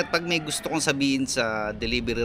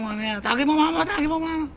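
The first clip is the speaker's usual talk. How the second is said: high mock voice; 14 semitones higher than usual. Rider voicing his request, retold.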